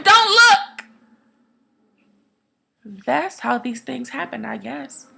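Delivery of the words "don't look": high, loud, horrified cry; urgent mother's scream; screamed warning. Acted. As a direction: scream it to shield a child from the sight. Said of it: "she screams to him".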